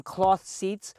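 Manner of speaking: ordinary talk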